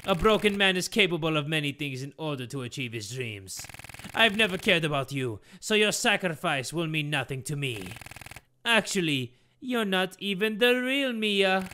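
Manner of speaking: put-on accented villain father confessing; hammed-up villain monologue; villainous Geppetto, cold. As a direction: reveal it without remorse.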